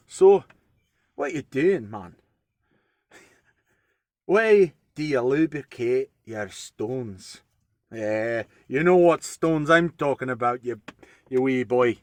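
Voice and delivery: put-on light Scottish dwarf accent, cheeky; a hint of a Scottish dwarven brogue. Joking to viewers about stones in a light Scottish accent. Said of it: "maybe I should do this in a dwarven accent, which is actually Scottish".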